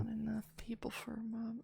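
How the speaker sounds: soft whisper to self. Real, not acted. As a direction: whisper it under your breath. Muttering to self.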